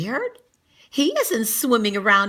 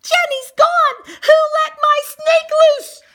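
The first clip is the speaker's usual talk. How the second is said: high, loud panicked shout; girl shouting in alarm; 10 dB louder than usual. Acted. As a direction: yell it across the classroom. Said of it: "Emily shouts".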